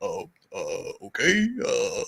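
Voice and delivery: low Obama-style uhs; Obama hesitation. Retold or imitated. Podcaster shows Obama voice from TV. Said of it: "the voice of Barack Obama".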